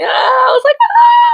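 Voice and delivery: a comic shriek of a woman who is smitten; mock swooning scream. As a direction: let out a hammed-up squeal as if swept off her feet.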